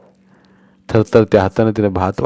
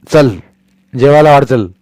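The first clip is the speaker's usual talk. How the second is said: snapped order; 5 semitones higher, 8 dB louder than usual. Acted. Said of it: "ते खेकसताच".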